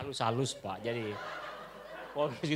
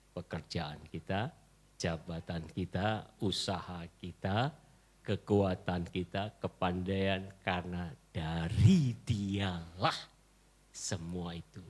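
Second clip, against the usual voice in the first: lower, slow, hushed listing; solemn prayerful litany; 8 semitones lower, slower than usual. Acted. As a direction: lay each item down gently before God. (litani khidmat, pelan dan rendah)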